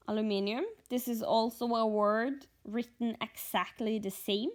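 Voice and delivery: ordinary talk, nothing marked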